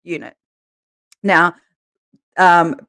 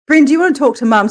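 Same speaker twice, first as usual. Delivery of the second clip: higher, fast, bright; imitating teacher; 7 semitones higher, 5 dB louder, faster than usual. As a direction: ask the child kindly. Teacher voiced high and quick.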